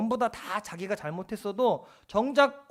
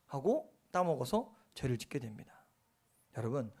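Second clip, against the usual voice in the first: normal talking voice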